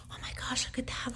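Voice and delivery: high, soft, airy gasp of wonder; quietly marvel, as if in a museum; imitated hushed excitement. Excited tourist at the museum, retold in a hushed high voice. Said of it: "I was so excited".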